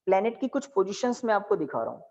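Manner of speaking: ordinary talk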